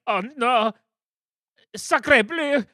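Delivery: put-on high, loud panicked French accent; French chef. Guard panics and flees.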